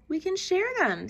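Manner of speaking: high sweet offering voice; friendly ladybug. A narrator's reading. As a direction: kindly suggest sharing. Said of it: "suggested the friendly ladybug".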